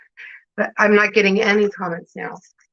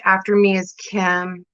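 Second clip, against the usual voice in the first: normal talking voice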